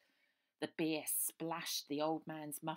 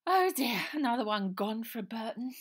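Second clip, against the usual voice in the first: much higher, loud plummy sigh of woe; theatrical posh dismay; posh girl, mock dismay; 7 semitones higher, 13 dB louder than usual. Posh girl laments rose.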